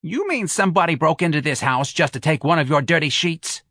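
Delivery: Mrs Reilly's voice. Acted. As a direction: scoff at his lame excuse.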